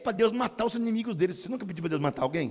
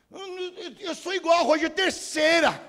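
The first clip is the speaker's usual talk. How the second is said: loud, higher joking call-out; joking shout; 9 semitones higher, 4 dB louder than usual. Real, not acted. Pastor joking loudly to the congregation. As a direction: shout the joke to the crowd.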